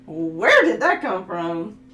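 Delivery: loud, drawn-out baffled question; slow, exaggerated disbelief; mock astonishment. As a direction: act stunned at what came out of you. Acts out surprise at own accent.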